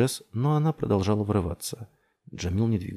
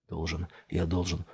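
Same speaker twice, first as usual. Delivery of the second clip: low-key, soft muttering; inner self-urging; 3 semitones lower, 5 dB quieter than usual. A narrator's reading. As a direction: drum it into yourself quietly. (тихо твердит самому себе)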